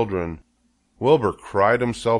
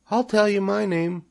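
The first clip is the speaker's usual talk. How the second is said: high, tiny creature voice; little spider; 13 semitones higher than usual. A narrator's reading. First spider bargains with Wilbur, high little voice. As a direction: answer small and pitched way up. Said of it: "replied the first little spider".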